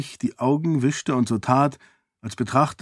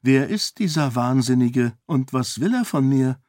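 usual speaking voice

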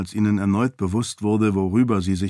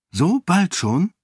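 higher, slow startled question; surprised; 9 semitones higher than usual. A narrator's reading. Sarene questioning the duke's account, surprised. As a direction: ask it in astonishment, pitch leaping. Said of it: "fragte Sarene überrascht".

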